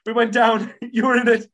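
high, loud, rushed nervous babble; nervous flyer. Acted. Nervous passenger telling a seatmate her dream, high and jittery. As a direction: blurt it out in hammed-up nerves. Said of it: "I'm just really nervous about flying".

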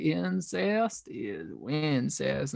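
quiet, drawn-out mock-drunk Southern voice; drunk Southern friend. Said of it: "She was so drunk".

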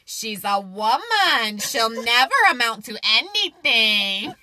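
sneering Mulan recorder guy. Retold or imitated. The Mulan recorder guy dismissing a woman, in a slow, smug sneer. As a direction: drag the words out with smug contempt. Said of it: "You got that voice, like, spot on".

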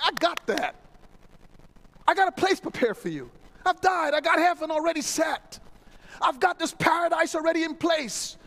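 God proudly announcing it's all set; God's voice, excited. Acted. God speaks of heaven.

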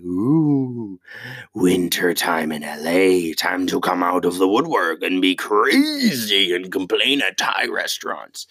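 slow drawn-out mocking voice of a difficult customer; mocking crazy LA lady. Comedian mocking LA customers, slow and sneering. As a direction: stretch it out like a smug complainer. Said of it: "They're like".

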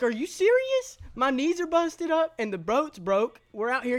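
high, loud incredulous protest; retold outrage. Soaked passenger to boat driver, incredulous retold outrage.

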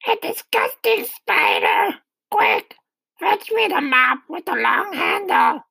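high, rough horrified screech; acted shriek of an appalled aunt; Aunt Sponge crying out. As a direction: screech the orders in disgust. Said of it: "she cried".

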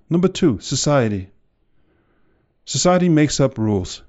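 loud, preaching fire to the crowd; booming motivational speech. Acted. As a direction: push every line out like a rally. Fiery motivational rant.

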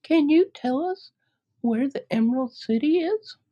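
put-on high girlish voice, hopeful; young Dorothy. Dorothy proposing to her friends, high girlish voice. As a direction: lift into a bright young girl.

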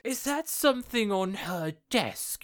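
high, drawn-out questioning as a villain; mock suspicious question. Retelling the villain's question to the Queen, mock suspicious.

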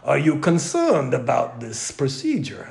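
put-on a light German doctor accent; lightly imitated German accent. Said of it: "He's got a real heavy accent".

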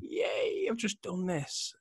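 very high little cheer; mock celebration. Acting out finishing a workout, mock celebration. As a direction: squeak out a drawn-out, playful hooray. Acts out relief after workout.

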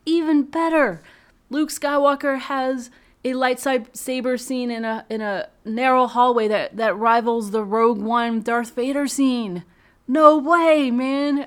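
excited gushing. Real, not acted. Thrilled fan recalling the scene.

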